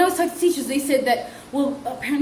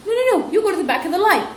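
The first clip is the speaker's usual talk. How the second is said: firm scolding; 8 semitones higher, 3 dB louder, faster than usual. Retold or imitated. Teacher scolding girl.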